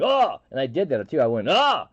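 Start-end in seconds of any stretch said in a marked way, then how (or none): 0.0-0.4 high, loud startled yelp. Retold or imitated. Startled as a stranger lands in his lap, high yelp. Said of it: "in surprise". 1.5-1.8 high, loud startled yelp. Retold or imitated. Startled as a stranger lands in his lap, high yelp. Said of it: "in surprise".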